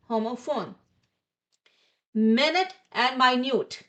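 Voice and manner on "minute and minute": minute and minute said very slowly, each word drawn out; slow teacher contrast. Shown as a demonstration. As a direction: spell out the two minutes slowly. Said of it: "इनको बोलने का तरीका अलग है".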